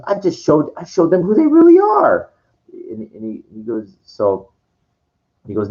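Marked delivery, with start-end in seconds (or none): 0.1-2.3 very high-pitched, rising retold justification; imitating defensive protest. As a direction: protest your innocence, voice climbing high. Retold Sybok answers Kirk's accusation.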